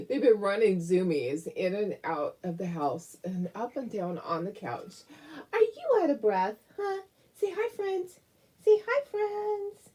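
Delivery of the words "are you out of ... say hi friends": high, coaxing pet voice; baby talk to dog. Acted. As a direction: coo at the puppy to say hi.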